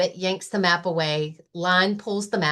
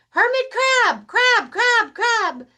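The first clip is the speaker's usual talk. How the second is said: high, loud call fading like an echo; 6 dB louder, slower than usual. Acted. Rabbit's call echoing over the water, high then fading. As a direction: let each crab echo away. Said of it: "It's just an echo".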